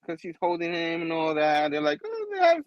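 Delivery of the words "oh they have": mock high-pitched coo; cooing onlookers. Onlookers watching the couple chat, high cooing voice. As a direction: lightly imitate onlookers in a much higher voice.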